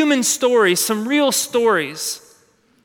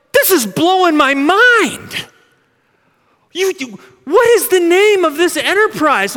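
amazed stranger; 7 semitones higher, 5 dB louder than usual. Acted. Stranger to the church, blown away and amazed. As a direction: gasp it out like your mind is blown.

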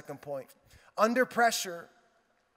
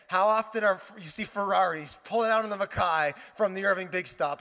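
ordinary talk

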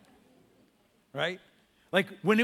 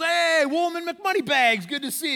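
loud, high whoop of hello; hearty greeting; 12 semitones higher, 8 dB louder than usual. Retold or imitated. College buddy greeting the rich guy, hearty and loud. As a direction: hail an old pal at full volume.

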